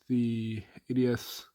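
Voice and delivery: ordinary talk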